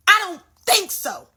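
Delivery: high, emphatic rebuff; defiant comeback. Acted. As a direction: shut the thought down. Talking back to a negative thought.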